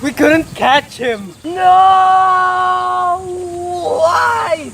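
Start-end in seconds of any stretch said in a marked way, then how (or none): 1.4-4.7 long, high, loud wail of defeat; mock dramatic despair. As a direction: wail it like a hammed-up film tragedy. Mock despair at failing to catch up.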